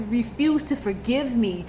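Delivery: normal talking voice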